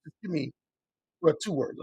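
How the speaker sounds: ordinary delivery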